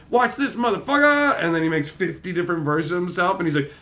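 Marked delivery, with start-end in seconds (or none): 0.1-1.4 high cocky challenge; a hint of swagger; mock cocky taunt. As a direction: taunt before the big move.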